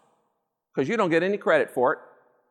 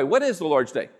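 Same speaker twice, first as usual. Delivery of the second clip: normal talking voice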